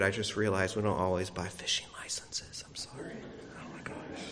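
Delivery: sheepish whispered confession to the congregation; hushed guilty aside, half laughing at himself; embarrassed whisper. Real, not acted. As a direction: drop to a whisper, caught out and a little embarrassed. Embarrassed aside about fishing licenses.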